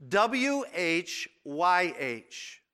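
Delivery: W H Y H read slowly as four separate letters; spelled out letter by letter. Shown as a demonstration. Preacher spells the Hebrew name for the congregation, slow letters. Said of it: "is spelled".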